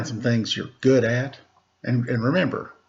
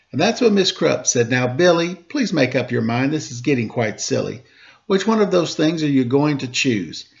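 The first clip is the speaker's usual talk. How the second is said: lively rhymed storybook reading; reading a story to children; 3 semitones higher than usual. A narrator's reading. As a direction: read the rhyming story aloud to the kids. Reads a rhymed picture book aloud.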